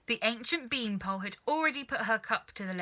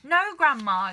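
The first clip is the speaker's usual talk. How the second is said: put-on a boy's loud, higher no; loud protest; 5 semitones higher, 10 dB louder than usual. George protesting to grandma, loud and higher. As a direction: call out to stop her.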